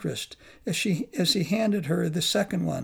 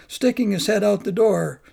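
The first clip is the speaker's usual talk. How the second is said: ordinary delivery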